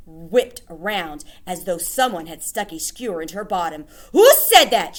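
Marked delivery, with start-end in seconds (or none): 4.1-5.0 high, loud snapped question; witch snapping. Acted. Grand High Witch snapping at unknown objector. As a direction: whip round and demand the culprit. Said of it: "she snapped".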